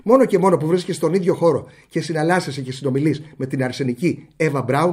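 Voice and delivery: usual speaking voice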